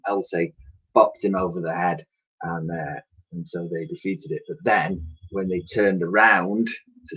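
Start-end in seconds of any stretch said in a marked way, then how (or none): none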